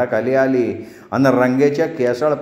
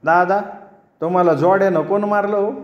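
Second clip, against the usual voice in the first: higher, sweetly mocking question; playful village jibe, pitched up; sweet but teasing; 6 semitones higher than usual. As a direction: tease him with sugar on it. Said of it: "गोड पण मिश्किलपणा म्हणाला".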